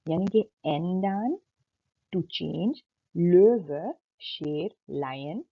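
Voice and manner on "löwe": löwe drawn out very slowly with a rounded ö; stretched umlaut ö. Shown as a demonstration. Teacher models löwe slowly for learners. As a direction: pull the ö in löwe long. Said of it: "थोड़ा सा लंबा खींच के".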